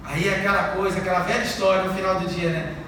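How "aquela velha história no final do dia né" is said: ordinary delivery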